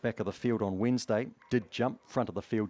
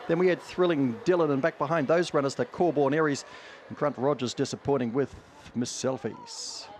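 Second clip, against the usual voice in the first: race caller; 5 semitones higher, 4 dB louder than usual. Acted. Race call placings.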